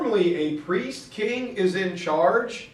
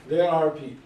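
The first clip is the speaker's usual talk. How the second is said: ordinary delivery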